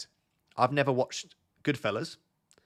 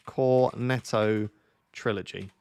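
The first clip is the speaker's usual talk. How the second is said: slow; 2 semitones lower, much slower than usual. Real, not acted. Drawing out the title slowly.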